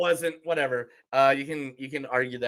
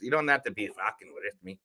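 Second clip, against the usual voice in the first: lower, softer put-on Irish; Irish accent; 5 semitones lower, 5 dB quieter than usual. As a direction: bar banter in mock Irish. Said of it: "It's from Ireland".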